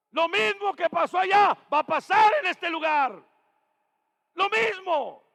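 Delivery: loud, high, shouted preaching; fiery pulpit preaching, shouting to the congregation; preaching at full force. Acted. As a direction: preach it out to the whole church. Preacher building to a climax.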